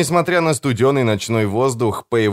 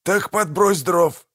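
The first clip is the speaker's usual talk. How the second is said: snapped; 9 semitones higher, slower than usual. A narrator's reading. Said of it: "огрызнулся Манфред".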